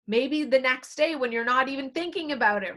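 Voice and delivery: ordinary delivery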